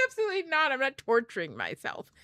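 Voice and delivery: high, loud mock outrage; exclaimed, over-the-top no; emphatic refusal. Retold refusal of husband's film idea.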